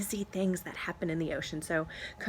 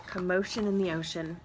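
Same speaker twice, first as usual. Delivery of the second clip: ordinary delivery, nothing marked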